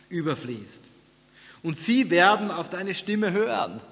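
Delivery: scripture reading aloud. Real, not acted. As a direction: read it out high and strong, easing toward the close.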